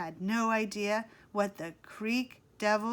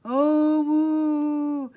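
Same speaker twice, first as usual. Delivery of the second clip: long loud monster moan rising; 5 semitones higher, 9 dB louder than usual. A narrator's reading. Chet scaring friends from the reeds, monster moan. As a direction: moan like a creek devil. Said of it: "he started to moan".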